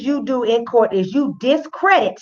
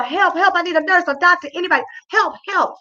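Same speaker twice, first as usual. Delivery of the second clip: much higher, urgent call; mock yelling for help; 7 semitones higher, faster than usual. Host imagines yelling out the door, mock high yell. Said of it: "I'd be yelling out the door".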